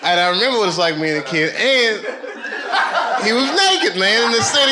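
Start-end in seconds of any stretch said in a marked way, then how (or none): 1.5-4.7 laughing high and loud as he tells it; cracking up at the memory. Real, not acted. Laughing retelling naked guy.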